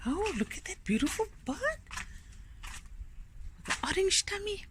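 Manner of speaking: thrilled by a bird, keep it soft; delighted hush. Real, not acted. Spotting a beautiful bird.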